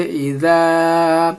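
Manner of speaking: "اذا" drawn out with a soft dh sound; soft zaal. Shown as a demonstration. Teacher showing zaal, "اذا" slowly with a soft ذ. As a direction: keep the ذ in "اذا" soft, lengthen the vowel. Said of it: "نرم پڑھی جاتی ہے".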